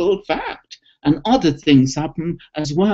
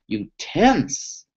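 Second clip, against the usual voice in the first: guided relaxation; 7 semitones lower than usual. Shown as a demonstration. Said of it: "so here we go".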